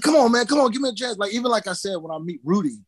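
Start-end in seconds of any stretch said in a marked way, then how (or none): 0.0-1.2 high, insistent mock begging; imitating a persistent, high-pitched pleader; pestering plea. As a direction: nag them like a pest for a chance. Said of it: "I was like that pestering person".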